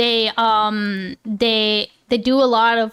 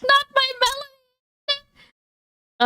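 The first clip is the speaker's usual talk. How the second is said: much higher, very slow hammed-up cry; mock wail; 13 semitones higher, much slower than usual. Streamer answering chat's teasing with a hammed-up anguished wail. Chat says his melanin is snatched.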